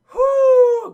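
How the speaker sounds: loud, high exhaled whoop of relief; mock sigh of relief, very loud; big relieved whew. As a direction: let out a hammed-up whew. [name] relieved at escape.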